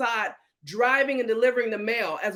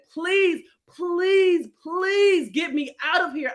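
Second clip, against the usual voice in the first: desperate plea; 6 semitones higher, 4 dB louder, slower than usual. Retold or imitated. Praying in the ambulance.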